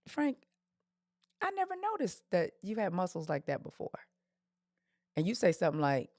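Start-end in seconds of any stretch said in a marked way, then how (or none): 0.1-4.0 softly voiced flirty compliment; flirty admiration. Woman softly flirting with her friend Frank, coy admiration. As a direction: say it softly, as if just noticing.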